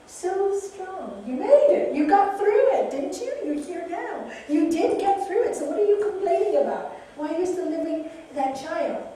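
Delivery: loud, high pep talk; rousing encouragement. Acted. To hurt listeners, loud rousing encouragement. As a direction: lift them up, then challenge them. Urging listeners they survived.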